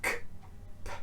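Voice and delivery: "K" and "p" as bare bursts of air; voiceless K and P. Shown as a demonstration. Teacher showing air just coming out, voiceless "K", "p". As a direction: let "K", then "p" pop with no voice. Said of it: "because the air just comes out".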